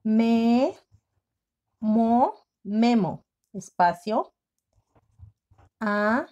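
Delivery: slow, syllables split with pauses; slow spelling dictation. Shown as a demonstration. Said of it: "Iniciamos con mayúscula".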